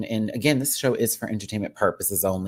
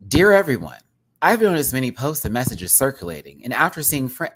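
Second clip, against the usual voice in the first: louder canned chatbot read; 4 semitones higher, 6 dB louder than usual. Shown as a demonstration. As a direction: stiff AI chat announcement. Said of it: "I can't help but talk like this".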